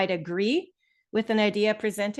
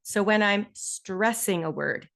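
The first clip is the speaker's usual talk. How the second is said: "stressing" dragged out slowly; slower than usual. Shown as a demonstration. Teacher drawing attention by voice, drawn-out "stressing". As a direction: take your time on "stressing". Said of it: "I take my time to draw your attention".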